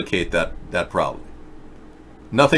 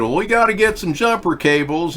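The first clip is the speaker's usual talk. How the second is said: put-on southern accent; 8 semitones higher than usual. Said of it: "southern accent".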